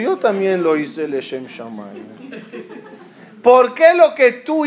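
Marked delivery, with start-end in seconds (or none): 0.0-2.1 lower, softer counter-claim; calm reply. Retold or imitated. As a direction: answer back evenly, lower. The other side's reply.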